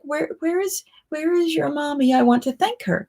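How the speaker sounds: put-on lightly childlike. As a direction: ask sweetly like a grateful child. Childlike voice thanking the girl.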